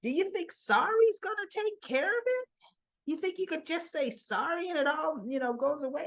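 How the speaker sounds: high-pitched retold indignation; mock mad. Woman snapping back at an apology, mock indignant. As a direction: act out her being mad, voice climbing. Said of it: "she got all mad".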